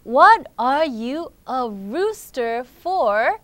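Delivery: very slow reading, stretching "What are you a rooster for?"; slow challenge. Acted. Friend challenges the young rooster, slow and emphatic. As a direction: draw out each word, loud and deliberate.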